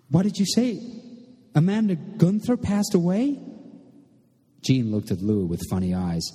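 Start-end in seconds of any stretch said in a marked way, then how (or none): 0.1-3.4 very high, panicked questioning; sudden panic. A narrator's reading. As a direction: demand it in alarm, pitch shooting up. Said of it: "shook with sudden panic".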